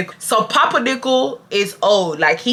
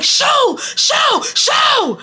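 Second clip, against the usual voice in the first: mock yell, very high-pitched; Papa Nichols; much slower than usual. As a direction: imitate an old man shooing people away. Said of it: "Papa Nichols starts yelling".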